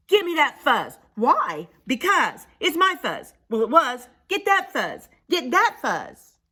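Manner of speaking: high, loud quarrelsome cries; squabbling prairie dogs. Acted. Prairie dogs squabbling over fuzz, quarrelsome. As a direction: fight over it like bickering critters.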